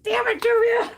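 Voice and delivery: sudden high, loud cry of frustration; mock-furious yell at a friend; exasperated outburst. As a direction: blurt it out at the little pans. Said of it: "I'm like".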